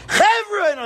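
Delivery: high-pitched shout to the crowd; demonstrating a bad speaker's shouted greeting; shouted speech opening. Shown as a demonstration. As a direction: open the scripted speech screaming. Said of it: "they're shouting and screaming".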